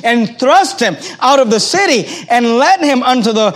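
loud, emphatic Bible reading; scripture reading. Acted. Preacher reads out the crowd's wrath at Jesus, forceful scripture reading.

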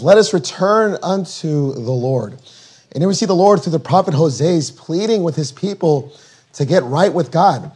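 ordinary talk

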